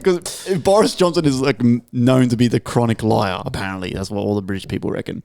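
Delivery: usual speaking voice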